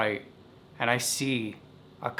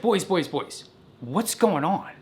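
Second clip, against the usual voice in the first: stern dad; 6 semitones higher than usual. Retold or imitated. Dad confronts out-of-breath boys.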